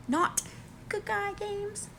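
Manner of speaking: high slow sarcastic question; mock their soft play. Real, not acted. Mocking the players' approach.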